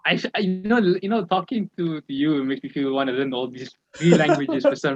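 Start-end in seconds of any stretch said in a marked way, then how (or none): none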